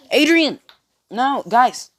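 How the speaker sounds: loud, high exasperated shout; calling out sharply. Real, not acted. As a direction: yell across to make them quit. Calls out at people interrupting.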